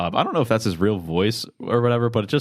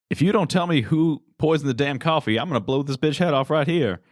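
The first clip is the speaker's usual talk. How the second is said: threatening movie gunman; 7 semitones higher than usual. Retold or imitated.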